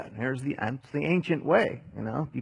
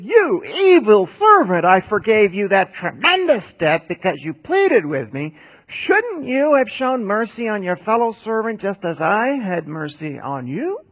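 angry king; 6 semitones higher, 8 dB louder than usual. Acted. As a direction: thunder down the first words. Angry king rebukes servant.

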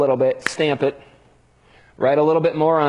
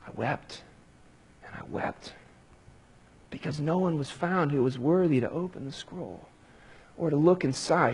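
hushed weeping; 3 semitones lower, 9 dB quieter than usual. A narrator's reading. John weeping over the sealed scroll.

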